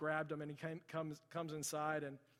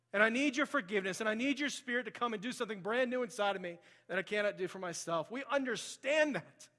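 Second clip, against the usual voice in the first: high, loud, impassioned preaching; full-force preaching; 7 semitones higher, 10 dB louder than usual. Real, not acted. Preacher urges church to say sorry before God, preaching loudly. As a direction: drive it home to the congregation, voice raised.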